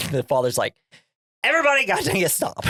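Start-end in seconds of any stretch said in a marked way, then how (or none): 1.4-2.6 high-pitched, strained imitation of a fed-up father; hammed-up cry for everyone to stop; comic exasperated yelp; mock outburst. Acted father breaking up quarrel.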